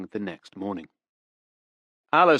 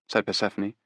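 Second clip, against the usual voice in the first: usual speaking voice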